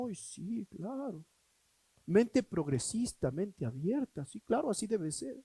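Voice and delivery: soft sing-song mocking of agreeing; mock meek agreement. Preacher mocking churchgoers who just agree. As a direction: parrot the crowd softly and sarcastically.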